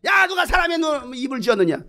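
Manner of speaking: high, shouted string of rebukes; God at boiling point. Acted. God asks Moses who made the mouth, angry shout. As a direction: thunder each question, stress mounting. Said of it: "스트레스 게이지가 한참 올라가시는 거예요".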